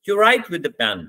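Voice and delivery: "pen" stressed with the voice falling then rising; fall-rise on pen. Shown as a demonstration. Teacher correcting a student who says pencil, fall-rise on pen. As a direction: correct the listener, diving on "pen". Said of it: "आप क्या कहोगे?".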